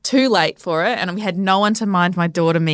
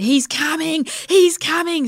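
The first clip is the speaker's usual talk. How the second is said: high-pitched re-enacted alarm; urgent cry, retold; 9 semitones higher, slower than usual. Labouring mother announces the baby, retold urgent cry. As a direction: call it out urgently, pitch jumping up.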